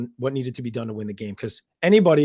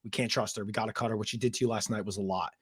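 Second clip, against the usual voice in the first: hushed quote; 5 semitones lower, 9 dB quieter than usual. Retold or imitated.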